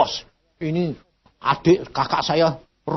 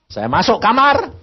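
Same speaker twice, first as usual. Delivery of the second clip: ordinary talk, nothing marked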